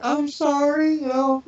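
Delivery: high, apologetic voice for the embarrassed wife; imitating his wife. Wife apologizing to Michael for not knowing him, mock sheepish. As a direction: play her sorry and embarrassed. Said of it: "And she's like".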